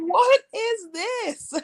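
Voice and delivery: very high, slow disbelief; stunned by the gossip; incredulous. Retold or imitated. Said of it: "I'm like".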